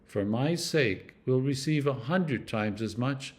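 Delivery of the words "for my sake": ordinary delivery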